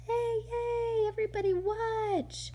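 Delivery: put-on high excited kids' cheer; cheering children. Reader voicing the school kids cheering, high and excited. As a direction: call out like kids at recess.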